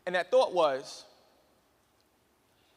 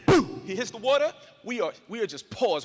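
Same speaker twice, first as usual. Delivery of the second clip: ordinary talk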